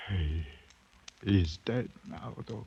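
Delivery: quiet mournful; grief. Acted. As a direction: let the sorrow sink in. Sudden grief.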